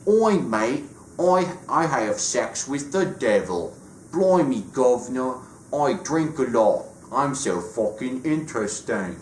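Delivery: put-on cheeky British accent, over the top; mock Cockney Constantine. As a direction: ham up a brash British bloke's accent. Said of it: "That's my John Constantine impression".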